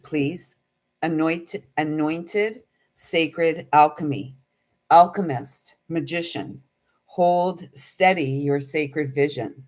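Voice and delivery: slow, measured oracle voice with pauses; channeled message reading. Acted. To her viewers, reading out a channeled message, slow and solemn. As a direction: intone it like a message from spirit.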